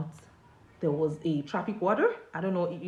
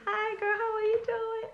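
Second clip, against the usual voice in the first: much higher, sing-song mock of his casual hello; mocking his greeting. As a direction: send up the billionaire's smooth phone greeting. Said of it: "he called me up ... he said".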